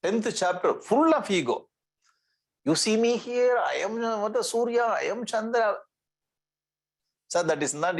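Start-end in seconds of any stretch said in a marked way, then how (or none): 2.6-5.8 higher-pitched, lightly voiced divine declaration; Krishna. Teacher quoting Krishna to students, lightly voiced god declaring himself. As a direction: declare it on a raised, sustained pitch. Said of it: "But when Krishna says that".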